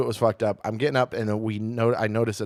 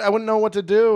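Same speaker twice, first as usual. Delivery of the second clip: normal talking voice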